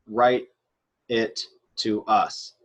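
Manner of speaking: "write it to us" slow, with gaps between words. Shown as a demonstration. Teacher translating the command for students, "write it to us" slowly word by word. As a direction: spell out "write it to us" one word at a time.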